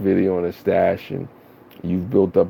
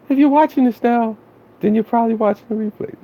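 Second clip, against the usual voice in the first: very high, louder feminine voice; Cargo voice; 3 dB louder than usual. Retold or imitated. Streamer doing a self-confessed bad impression of Cargo's intro. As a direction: pitch way up and sell her intro. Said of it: "let me use it in my Cargo voice".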